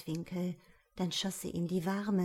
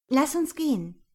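eager call; 6 semitones higher, 7 dB louder than usual. Acted. Tammy claps, urges leaving.

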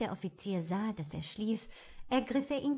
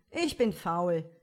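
lazy fool voice; 4 dB louder than usual. A narrator's reading. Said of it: "sagte Emelian".

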